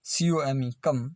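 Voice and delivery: ordinary talk, nothing marked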